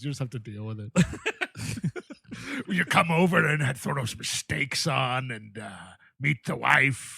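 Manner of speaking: half-joking Scottish brogue, lightly done; Scottish accent. Shown as a demonstration. Friend showing off his Scottish accent, lightly mock. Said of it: "This is my Scottish accent".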